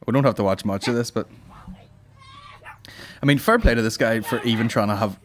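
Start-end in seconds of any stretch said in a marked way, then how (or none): none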